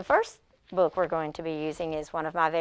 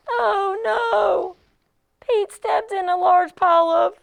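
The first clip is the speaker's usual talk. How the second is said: high, loud mock dismay; dramatic storytime alarm; 13 semitones higher, 6 dB louder, slower than usual. Shown as a demonstration. Teacher dramatizes Pete's mishap for pre-K kids, high and loud. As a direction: gasp it out for the little ones.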